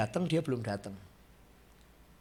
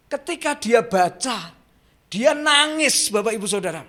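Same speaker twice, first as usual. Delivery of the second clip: loud and much higher, crying out; full-force preaching; 12 semitones higher, 11 dB louder than usual. Acted. Preacher cries out to the congregation how the man knelt and wept, at full force. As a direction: shout the moment he wept before God. Climax of conversion story.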